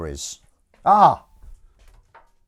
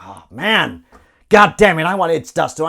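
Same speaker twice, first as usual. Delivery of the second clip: loud, high outburst of annoyance; mock frustration; 5 semitones higher, 12 dB louder than usual. Voicing a viewer whose username is taken, mock-frustrated outburst. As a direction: curse it in hammed-up exasperation. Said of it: "you're like".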